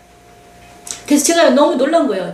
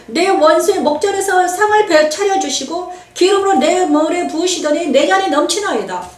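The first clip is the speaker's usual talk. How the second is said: higher, solemn scripture chant; psalm recitation; 5 semitones higher than usual. Acted. Preacher reciting Psalm 23 to the congregation, solemn intoning.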